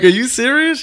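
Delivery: high-pitched, loud, incredulous question; amazed disbelief. Retold or imitated. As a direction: ask it like you can't believe your luck. Disbelief at being asked to play.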